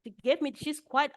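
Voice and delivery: ordinary delivery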